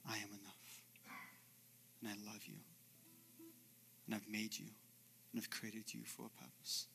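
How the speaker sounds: very soft, low, close whisper; Jesus whispering love up close; hushed whisper. Acted. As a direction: breathe it right into the ear. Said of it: "But Jesus whispers".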